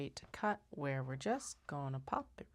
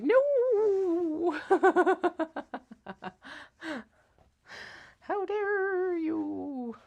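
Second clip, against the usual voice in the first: high, loud, wailing ghostly inspector; 13 semitones higher, 11 dB louder, much slower than usual. Acted. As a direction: wail it out like a hammed-up ghost. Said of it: "they've got a ghost voice".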